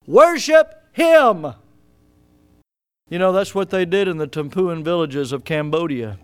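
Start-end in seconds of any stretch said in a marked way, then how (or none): 0.1-1.5 high, loud, drawn-out cry. Retold or imitated. Preacher voicing David's cry to the congregation. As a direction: lift the words up slowly, loud. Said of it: "he cries out and says".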